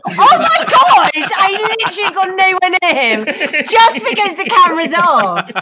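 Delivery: high, loud outburst through laughter; laughing, outraged shriek of denial; loud indignant protest. Real, not acted. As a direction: protest the accusation at full voice. Mock outrage at a joking accusation.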